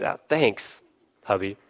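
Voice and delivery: soft, lightly voiced wife thanking her hubby; a small, sheepish thank-you; mock shy thanks. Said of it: "Would any of you be like".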